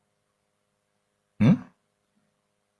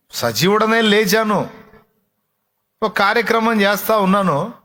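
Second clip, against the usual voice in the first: raised declaration; 6 semitones higher than usual. Retold or imitated. Risen Jesus declares to Mary.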